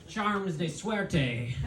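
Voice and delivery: put-on leprechaun's Irish accent crossed with Spanish. Leprechaun being chased, Spanish-Irish accent. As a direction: exclaim it as a Spanish leprechaun, drawing out the end. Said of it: "That's a Spanish leprechaun".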